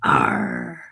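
deep pirate arr; pirate growl. Acted. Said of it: "International Talk Like a Pirate Day".

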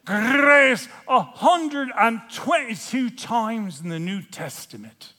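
loud, high brogue with rolled R's; Scottish or Irish accent. Shown as a demonstration. Preacher counting grace in the New Testament, Scottish or Irish accent. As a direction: proclaim it in a broad Celtic accent. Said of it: "you can't say it except for in Scottish or Irish".

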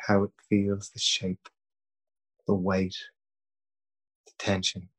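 calm, even meditation voice with long pauses; guided meditation. Acted. Leading listeners through breathing and self-thanks, calm guided meditation. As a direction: guide them gently, leaving long silences between phrases.

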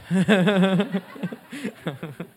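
loud, high burst of laughter; laughing. Real, not acted. Preacher amused at his own question to God, laughing. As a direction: laugh out loud, trailing into chuckles.

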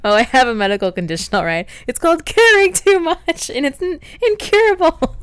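imitated high-strung yelling; loud, high, overwrought outburst, hammed up for laughs; Craig. Host quoting a man's over-caring medical condition, mock yelling. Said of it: "Whenever Craig yells".

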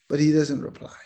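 ordinary talk

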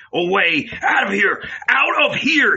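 building to a high, loud shout; furious shout. A narrator's reading. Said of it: "he shouted".

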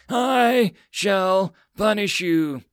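put-on high, loud, slow girlish threat; angry girl mage. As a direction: declare the punishment through tears. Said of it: "cried out angrily".

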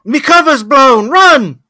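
put-on loud, high British-accented yell; panicked British-accented goblin. Exposed goblin cop warns his partner, British-accented shout. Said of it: "it was the accent".

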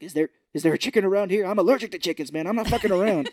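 loud, high, fast panicked rant; paranoid Gnome King. Retold or imitated. Host voicing Gnome King panicking about chickens, loud and fast. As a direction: play him jittery and freaked out.

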